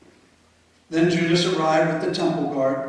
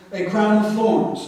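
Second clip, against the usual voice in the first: plain reading voice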